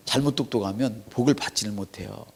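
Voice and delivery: ordinary talk, nothing marked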